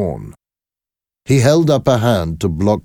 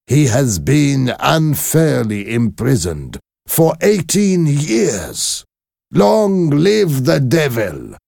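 slow, raised voice on the last words; bitter shopkeeper; 8 semitones higher, slower than usual. Acted. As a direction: drag out the grievance with bitterness. Defarge's angry outburst.

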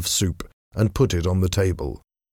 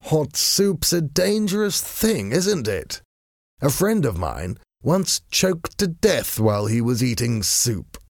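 lazy aristocrat needling his enemy, amused; foppish gentleman; 6 semitones higher than usual. A narrator's reading. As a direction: tease the choking villain with idle charm.

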